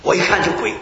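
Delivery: ordinary delivery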